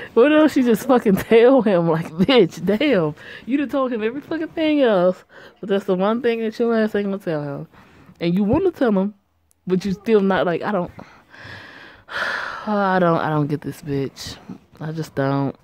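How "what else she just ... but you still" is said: loud, higher exasperated rant. Real, not acted. Player rants at the heroine, loud and exasperated. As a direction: vent at the character's choices. Ranting at the character.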